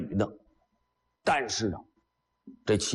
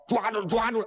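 hunters' shout; 11 semitones higher than usual. Acted. Hunters shout from above the pit.

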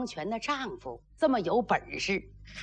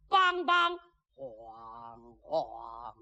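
imitated watch clapper and gong; 11 semitones lower, much slower than usual. Storyteller marking the second watch, imitated clapper and gong. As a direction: sound out the night watch, gong fading low.

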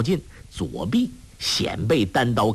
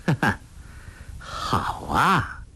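mocking "ha ha", then a drawn-out remark; scornful, sarcastic approval; cold laugh; 4 dB quieter, slower than usual. A narrator's reading. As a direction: sneer with a dry acted laugh. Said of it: "尹志平冷笑道".

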